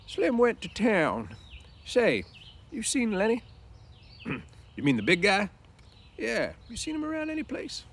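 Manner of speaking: put-on hesitant old ranch hand asking; old Candy. Candy at the doorway asks about Lenny in a high old voice.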